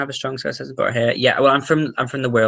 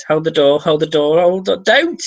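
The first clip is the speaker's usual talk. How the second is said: loud quoted chant, climbing higher; mock urgent cry; 5 semitones higher, 8 dB louder, slower than usual. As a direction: call it out louder and higher, playfully urgent. Quotes the show's repeated cry.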